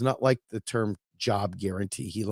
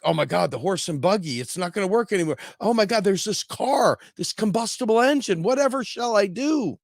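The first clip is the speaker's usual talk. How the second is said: hammed-up rising cartoon despair; melodramatic buggy maker; 7 semitones higher than usual. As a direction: pitch climbing from a little high to much higher. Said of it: "It's like".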